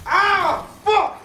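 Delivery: loud, high outburst of real frustration; frustrated shout. Real, not acted. Gamer screaming after the monster gets him, furious yell. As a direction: blow up after a miss.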